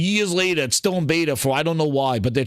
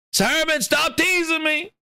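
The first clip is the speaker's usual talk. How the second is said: usual speaking voice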